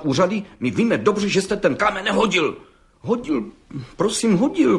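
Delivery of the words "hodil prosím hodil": put-on the young brickmaker stammers before the judge, high and halting; stammering. A narrator's reading. Stammering brickmaker. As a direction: stammer it out, words catching high. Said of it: "koktal mladý cihlář".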